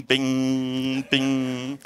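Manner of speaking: soft, even electronic beeps; quiet mock monitor bing; imitated monitor beep. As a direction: mimic the monitor pinging. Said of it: "the monitor is going bing".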